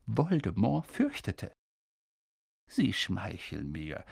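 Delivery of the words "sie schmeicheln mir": low, slow and soft; quiet, slow humble reply; said softly. A narrator's reading. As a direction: gently wave off the compliment. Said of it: "sagte Dumbledore leise".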